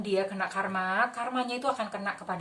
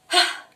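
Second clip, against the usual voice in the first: loud sharp "Hah!" throwing it away; shout; 12 dB louder than usual. Shown as a demonstration. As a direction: fling it out with a full yell. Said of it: "Jadi seperti ini".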